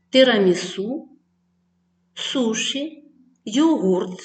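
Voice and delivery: normal talking voice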